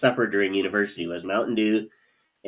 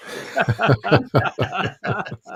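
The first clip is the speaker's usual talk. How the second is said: frog-voiced laugh; 11 semitones higher, 4 dB louder than usual. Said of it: "Kermit the Frog impression".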